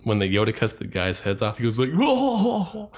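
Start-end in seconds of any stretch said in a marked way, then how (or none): 1.9-2.9 high, loud mocking whoops; imitated moviegoer laughing. Annoyed host imitates laughing guy in theater, high mocking hoots. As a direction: mimic an obnoxious laugher with hooting whoas. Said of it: "some guy laughing in a theater".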